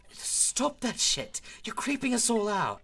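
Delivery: high, loud, freaked-out protest; high-pitched alarm; creeped-out outburst. Acted. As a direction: cry out for the creepy noise to stop. Character begs glitching professor to stop.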